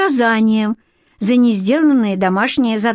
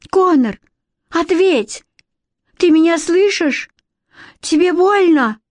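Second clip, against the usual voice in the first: high-pitched, worried calls; frightened calling; 8 semitones higher, 3 dB louder than usual. A narrator's reading. Alex calls over the wall to her brother, frightened. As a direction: demand an answer, fearing he is injured. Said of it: "Испугавшись не на шутку".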